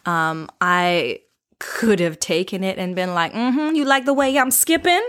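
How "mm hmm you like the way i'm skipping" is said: higher, playful mock-flirty purr; flirty tease. Woman playing along with the catcaller, mock flirty. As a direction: toss it back to him teasingly. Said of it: "If I wanted to play and be happy with it".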